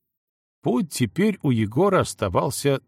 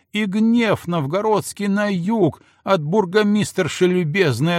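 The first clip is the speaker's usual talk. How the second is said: гневная отповедь; 7 semitones higher than usual. Acted. Жена продолжает ссору.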